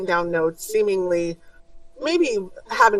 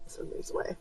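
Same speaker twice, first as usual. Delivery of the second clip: breathy whisper, almost no voice; 16 dB quieter, much faster than usual. A narrator's reading. GM whispering to the players, a hushed whisper. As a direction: whisper it like the guards might hear. Guards leaving in hushed scene.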